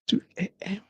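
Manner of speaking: soft, drained echo of the hour; hushed repeat. Acted. Parent up with a screaming baby at night, hushed repeat. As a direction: say TWO A M quietly, trailing away.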